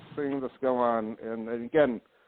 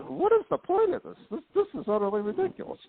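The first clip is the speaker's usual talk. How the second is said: high-pitched retold exasperation; exasperated protest; 13 semitones higher than usual. Telling Brenda the store trip was pointless, exasperated. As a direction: replay your indignant protest, pitch jumping up.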